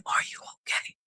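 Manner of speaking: hushed worried question, no voice; imitating a whisper. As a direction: whisper it the way the stranger did. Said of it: "I don't know why I'm whispering like this".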